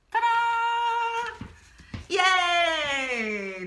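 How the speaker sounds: sung high ta-da then yay; ta-da sung up high, then cheer; cheery reveal. Real, not acted. Reveals finished rose drawing.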